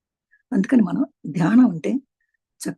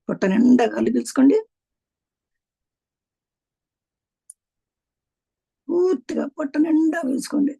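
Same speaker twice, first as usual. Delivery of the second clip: higher-pitched, stretched-out breathing cues; breathing guidance; 4 semitones higher than usual. Shown as a demonstration. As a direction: draw out the key words to pace the breath. Leads breathing, stretching the key words. (శ్వాస సూచనలు, సాగదీసిన పలుకు)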